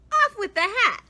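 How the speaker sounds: high, loud command; gruff order. A narrator's reading. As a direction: bark it at the kid. Photographer ordering kid.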